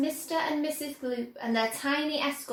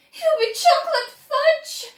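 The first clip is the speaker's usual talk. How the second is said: much higher and louder, a horrified shriek; 14 semitones higher, 9 dB louder than usual. A narrator's reading. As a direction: scream it in horror. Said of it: "shrieked Missus Gloop".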